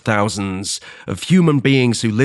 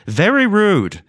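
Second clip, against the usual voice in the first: mock offended; 9 semitones higher, slower than usual. Repeats the complaint twice.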